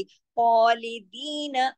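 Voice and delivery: slow खालिदीन, long dee and brief na; short final noon. Shown as a demonstration. Teacher warns against stretching noon, खालिदीन with a short na. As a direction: stretch दी and keep the last na short. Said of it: "नून सिर्फ एक हरकत पढ़ी जाएगी".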